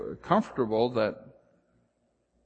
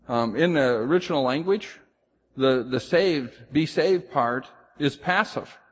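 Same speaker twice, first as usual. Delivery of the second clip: ordinary talk, nothing marked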